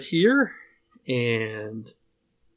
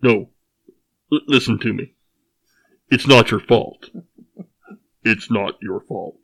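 Vader voice. Acted. Reading Good Will Hunting-style joke.

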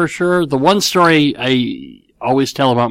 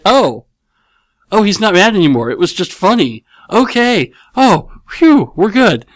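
put-on relieved kids; 7 semitones higher than usual. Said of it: "finally we get".

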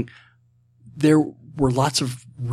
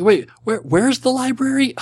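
high-pitched, baffled comic quote; hammed-up confusion, voice climbing high; mock bewilderment; 13 semitones higher, 4 dB louder than usual. Guest lost in Niles's gorgeous apartment, mock bewildered. Acting out a Frasier joke.

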